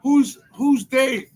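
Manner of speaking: confused asking. Retold or imitated. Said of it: "they're like".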